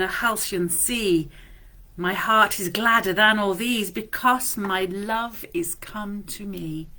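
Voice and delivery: slow, measured love poem recital; tender reading of a Scottish love poem. Acted. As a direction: savour each line like a love letter. Reads Rossetti poem aloud.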